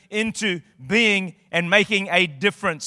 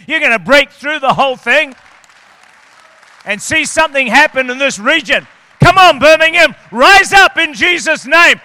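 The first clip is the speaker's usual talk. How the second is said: full-force preaching; 7 semitones higher, 12 dB louder than usual. Real, not acted. Pastor calling Birmingham to rise, shouting. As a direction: rouse the whole city to rise up. Climax of prophetic word.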